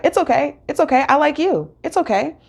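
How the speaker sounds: lightly voiced soothing man; reassuring.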